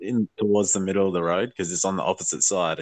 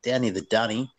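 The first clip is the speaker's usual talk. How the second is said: higher-pitched, hammed-up Ocker Australian accent; broad Ocker Aussie; 4 semitones higher than usual. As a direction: typical Ocker Aussie put on for laughs. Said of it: "there's definitely dudes like that".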